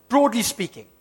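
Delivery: ordinary delivery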